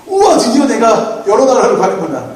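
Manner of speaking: mock eager Jeremiah. Pastor voicing a Jeremiah eager to go abroad, mock excited. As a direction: play a giddy traveller. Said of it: "예레미야의 대답은 뭘까?".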